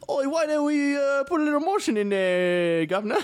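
high-pitched Australian attempt that lands British; hammed-up Aussie accent gone cockney; bad Australian accent. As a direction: squeal it in a botched Australian accent. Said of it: "He's Australian, I guess, so he'd be like".

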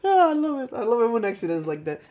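high delighted squeal. Real, not acted.

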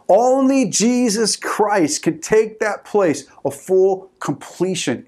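high-pitched, driving sermon delivery; impassioned preaching. Real, not acted. Preacher telling congregation only Jesus completes them, impassioned preaching. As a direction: press every phrase home to the congregation.

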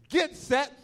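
high, loud race command; mock starter's shout; starter's call. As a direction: imitate a brother calling the start.

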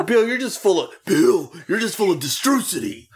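a wrestler whining at his boss; pleading Warrior. Retold or imitated. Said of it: "He's like".

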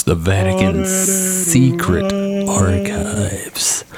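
slow, high, spooky announcement; ominous dramatic. Pretend. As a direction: say it like a dark mystery. Dramatic reveal of secret archives.

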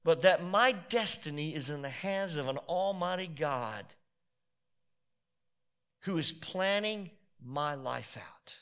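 ordinary delivery

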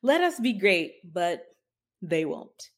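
normal talking voice

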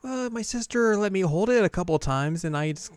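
high put-on girlish voice; modest anime girl. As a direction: voice a sheepish younger sister. Ui explains herself, retold.

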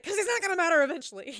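fast, high, loud retort; mock exasperated yell. Retelling her answer to the boyfriend, fast mock yell. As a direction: fire it back loudly in a mock quarrel. Retold lovers' quarrel.

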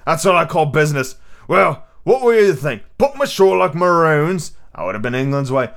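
pirate cook, approving. Acted. Silver answers Dick about the captives, animated. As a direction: weigh grim options with pirate relish. Narrator voices Silver debating.

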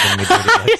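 fast, laughing through the words. Real, not acted. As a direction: giggle while protesting. Teasing banter, laugh heard.

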